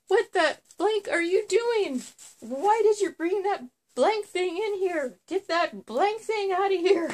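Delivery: high, loud imitation of a freaked-out husband; hammed-up shriek of alarm; mock panicked yelling. Husband yelling at his wife over the snake, high mock panic. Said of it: "screaming like a little girl".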